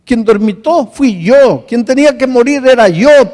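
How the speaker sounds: grieving husband cries out to the pastor, anguished and loud; anguished outcry. Retold or imitated. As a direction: cry out the guilt of the crash that killed his wife. Widower's anguished confession, retold.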